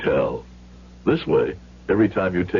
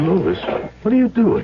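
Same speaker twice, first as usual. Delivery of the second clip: high, louder alarmed shout; alarmed cry; 11 semitones higher, 3 dB louder than usual. Acted. Father seeing his son pay the racketeer, alarmed shout.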